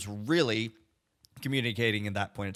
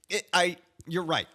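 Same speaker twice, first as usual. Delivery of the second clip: ordinary talk, nothing marked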